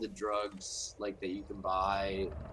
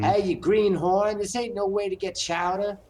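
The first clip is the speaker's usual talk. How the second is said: put-on loud, high Boston accent; 11 semitones higher, 6 dB louder than usual. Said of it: "a Boston accent being like".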